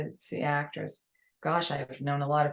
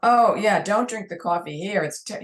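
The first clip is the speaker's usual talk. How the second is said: put-on New York accent; 4 semitones higher, 6 dB louder than usual. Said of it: "with my New York accent".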